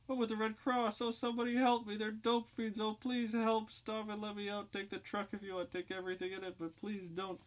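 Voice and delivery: put-on a girl terrified she has been kidnapped; shrill panic. Kidnapped Red Cross girl begging the dope fiends, shrill panic. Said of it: "the girl's voice rising to an alarming intensity".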